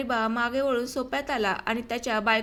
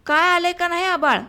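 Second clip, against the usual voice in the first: loud call from another room; 4 semitones higher, 7 dB louder than usual. A narrator's reading. Hariba calling to his wife from the front room, loud. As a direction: raise the voice to reach her inside.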